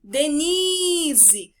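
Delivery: DENISE called out with a long held NI; drawn-out calling of Denise, strong on NI. Shown as a demonstration. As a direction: call "Denise", stretching the middle syllable. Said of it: "estou chamando a Denise".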